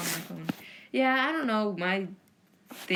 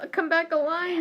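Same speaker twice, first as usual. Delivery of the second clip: dramatic plea; 10 semitones higher, 3 dB louder than usual. Pretend. Pleading with the stream.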